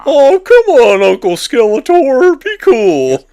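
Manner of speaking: high, loud, slow silly voice. Pretend. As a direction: play it goofy and pleading. Voicing He-Man playfully.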